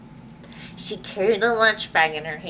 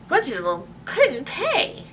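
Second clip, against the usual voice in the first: usual speaking voice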